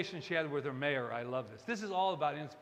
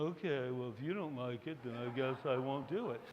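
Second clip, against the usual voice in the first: low, deflated, giving up; bubble burst; 4 semitones lower, 4 dB quieter than usual. Acted. Kid whose idea got a cool reply, mock deflated. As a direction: drop the voice like a let-down kid. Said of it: "getting my bubble bursted".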